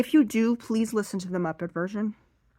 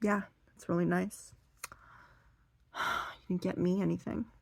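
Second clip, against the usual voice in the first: softer, underwhelmed mother voice; imitated mom; 7 dB quieter than usual. Grandmother to her daughter over a gift, quietly put out. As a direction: quietly play a slighted mother. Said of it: "And her mom's like".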